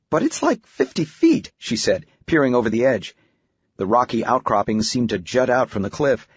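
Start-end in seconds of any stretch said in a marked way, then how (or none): 0.1-1.5 very high, alarmed disbelief; incredulous daughter. Acted. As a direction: balk at it in a high girlish voice. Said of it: "recalling her incredulous reaction".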